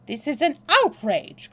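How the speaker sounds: high, loud outraged cry; high, hysterical. A narrator's reading. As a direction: protest in high-pitched hysteria. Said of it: "in a high, hysterical voice".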